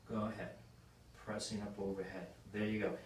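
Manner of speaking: soft, low-key cue to the lifter; murmured instruction right beside the client; quiet coaching cue. Real, not acted. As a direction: talk him through the press under your breath. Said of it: "coach cueing client mid-rep".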